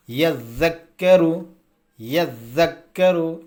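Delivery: یذکرو slow and measured, both doubled consonants pressed hard; firm doubled-letter reading. Shown as a demonstration. Teacher showing students the reading, firm slow یذکرو. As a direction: recite یذکرو deliberately, leaning on each doubled letter. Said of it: "مطلب سختی سے".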